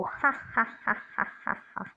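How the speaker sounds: breathy unvoiced cackle fading away; evil witch cackle. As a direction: cackle like a wicked witch, dying off. Witch laughs evilly. (भयानक चुड़ैल की हँसी)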